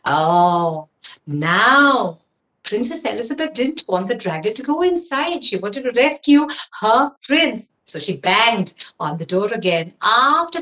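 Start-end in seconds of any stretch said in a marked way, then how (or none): none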